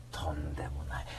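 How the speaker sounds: breathy aside whisper; a playful whispered aside. Real, not acted. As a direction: hush it like a secret. Teasing listeners, show not ending.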